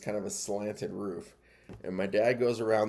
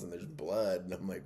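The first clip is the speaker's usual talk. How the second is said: ordinary delivery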